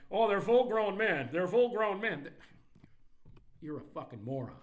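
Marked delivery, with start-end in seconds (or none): 0.1-2.3 loud mocking whine of a pundit; mock whining. Pundit whining about grown men, mocked loudly. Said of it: "whining and bitching that".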